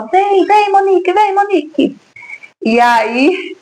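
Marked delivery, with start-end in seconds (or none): 0.0-2.0 high, urgent come-along call. Retold or imitated. Airline worker hurrying the girl onto the plane, calling out. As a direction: beckon her to hurry aboard. Crew member calling her aboard.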